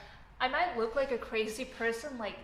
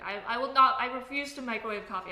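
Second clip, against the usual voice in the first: emphatic refusal; 2 semitones higher, 9 dB louder than usual. Real, not acted. Refusing to be a geek.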